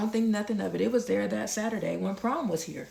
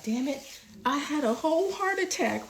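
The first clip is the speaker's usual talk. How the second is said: much higher, alarmed thought voiced aloud; panicked inner thought; 8 semitones higher than usual. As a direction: blurt the inner panic, pitch shooting up. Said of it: "thinking in my head".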